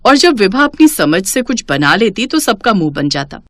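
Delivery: ordinary delivery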